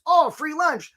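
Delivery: light, pleased crab spotting free bait; happy crab. Retold or imitated. Bob the crab finding the bait in the cage, high and delighted. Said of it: "Bob the crab comes along and says".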